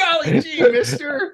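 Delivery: put-on high golly-gee kid voice, laughing; gee-whiz kid, laughing through it; wholesome 1950s kid. Hammed-up gosh-wow exclamation.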